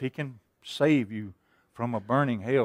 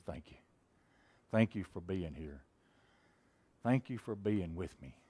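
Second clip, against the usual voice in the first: soft prayer; 7 semitones lower, 9 dB quieter than usual. Pretend. Imagined prayer beside the road.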